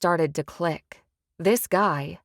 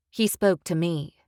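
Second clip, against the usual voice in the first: ordinary delivery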